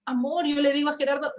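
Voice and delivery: usual speaking voice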